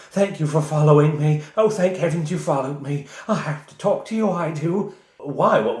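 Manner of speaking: put-on high, trembling anxious old lady; frightened old woman. Old woman confiding in the party, high and afraid. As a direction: rush out her fear in a high voice. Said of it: "She's shaking. She is afraid".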